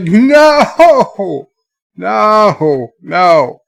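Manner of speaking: drawn-out, high, loud NO, then easing; mock-horrified outcry; retold cry of protest. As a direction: wail "no" in dismay, letting it settle. Retold horrified reaction to dream news.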